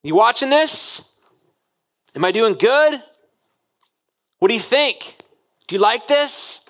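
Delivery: put-on a child checking with Dad mid-show, high and eager; eager child seeking approval. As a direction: sound like a child desperate for praise. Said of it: "we are constantly doing our life going".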